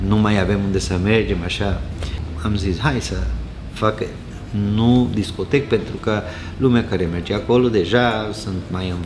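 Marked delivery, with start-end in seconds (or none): none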